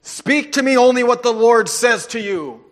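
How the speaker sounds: loud, indignant command; angry king. Acted. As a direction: demand it loudly, like an offended king. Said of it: "was angry".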